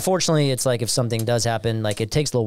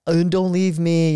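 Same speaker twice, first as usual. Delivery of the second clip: slow, higher, louder mock whimper; hammed-up clingy parent begging; mock clingy whine; 6 semitones higher, 4 dB louder, slower than usual. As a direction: drag out a pleading don't leave me.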